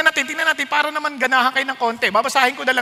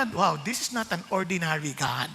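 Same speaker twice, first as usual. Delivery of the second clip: awed hush; 8 semitones lower, 11 dB quieter than usual. Acted. Awed reaction quoted.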